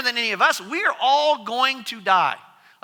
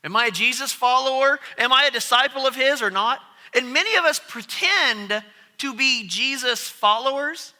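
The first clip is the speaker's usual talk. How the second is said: ordinary talk, nothing marked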